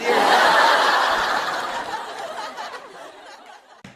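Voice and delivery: high, loud burst of laughter; howling at her own joke; loud cackling laugh. Real, not acted. Laughing hard to her viewers at the fans' outrage.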